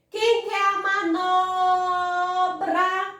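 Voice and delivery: "manobra" sung out with a long held NO; calling out the word. Shown as a demonstration. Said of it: "o som mais estendido".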